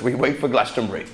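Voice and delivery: lightly put-on West Country voice, low; mock West Country local, understated; West Country accent. Locals answering what they do there, mock West Country accent. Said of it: "shit attempt at the accent".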